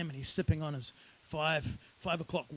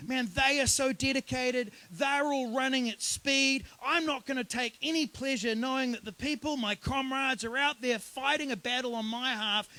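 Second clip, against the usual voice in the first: earnest protest; 6 semitones higher than usual. Retold or imitated. Preacher paraphrases Uriah's indignation.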